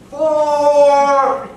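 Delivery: full-lunged warning shout, loud and high; yelling four. Retold or imitated. Dad warns golfers of the stray shot, loud yell. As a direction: bellow it across the golf course. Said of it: "at the top of his lungs, yells".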